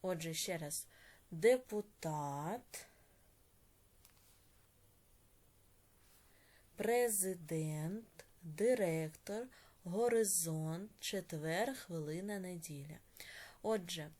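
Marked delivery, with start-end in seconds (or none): 1.3-13.0 drawn-out words with rising list tune; slow dictation. Shown as a demonstration. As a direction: dictate slowly, each word left hanging. Teacher dictates the list once more.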